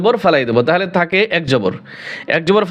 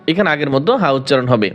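usual speaking voice

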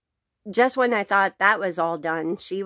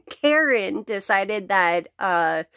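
normal talking voice

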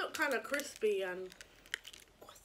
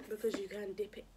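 whispering; 5 semitones lower, 7 dB quieter than usual. Real, not acted. Whispered banter.

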